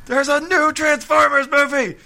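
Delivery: high loud excited squeal; overexcited fangirl. Pretend. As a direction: gush about the movie. Voices Erin excitedly.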